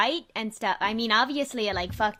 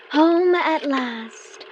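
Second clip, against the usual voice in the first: very slow, high and loud, savouring each word; grand slow homecoming; drawn-out arrival; 6 semitones higher, 10 dB louder, much slower than usual. Acted. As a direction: sigh out the relief of coming home.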